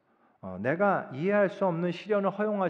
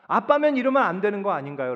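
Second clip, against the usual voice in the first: higher, louder acted complaint to a father; angry protest; 4 semitones higher, 5 dB louder than usual. Believer complaining to God as to a father, acted indignant protest. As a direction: protest like a let-down child, mildly indignant. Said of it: "우리가 하나님께 화가 나요".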